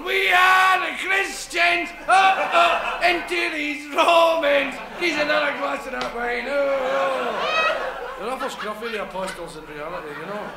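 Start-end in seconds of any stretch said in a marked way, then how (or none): 0.0-8.2 put-on high, loud, slow drunken bawl. Apostles bawling in a tavern over wine, drunken shouting. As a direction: bellow it like rowdy drinkers. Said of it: "singing and shouting and bawling".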